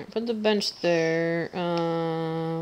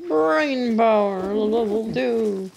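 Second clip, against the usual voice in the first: announcer voice; 5 semitones higher, 5 dB louder, much slower than usual. Acted. Game upgrade read slowly.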